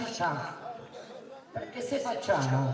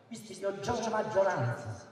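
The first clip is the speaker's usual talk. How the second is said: usual speaking voice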